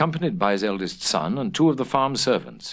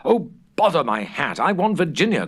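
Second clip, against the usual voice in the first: laughing cry; 6 semitones higher, 3 dB louder than usual. A narrator's reading. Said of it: "cried the little Duke, laughing".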